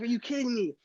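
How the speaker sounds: high, incredulous retelling; disbelief. Retold or imitated. Retold disbelief at dad.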